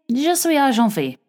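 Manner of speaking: high-pitched mock French accent; hammed-up worldly French; put-on French. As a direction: show off tape-learned French. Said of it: "I too am a woman of the world with my Muzzy tapes".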